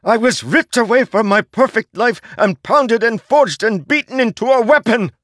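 very high, loud, desperate shrieking; shrieking at the herald who forged him; anguished shriek. A narrator's reading. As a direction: tear it out of him in despair. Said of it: "Szeth shrieked".

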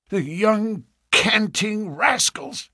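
put-on high, slow, indignant old man; exclaiming. A narrator's reading. Ferrier fuming to his daughter, high and slow. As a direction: fume after they've gone. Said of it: "he exclaimed".

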